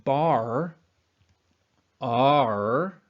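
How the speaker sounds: teacher models bar; careful demo. Shown as a demonstration. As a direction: say bar without raising the vowel. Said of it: "whenever I say".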